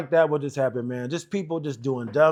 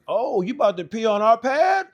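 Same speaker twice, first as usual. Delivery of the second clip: higher-pitched mock disbelief, rising at the end; incredulous challenge; 7 semitones higher, 3 dB louder than usual. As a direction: imitate an instructor daring a recruit. Instructors challenge the recruit, retold.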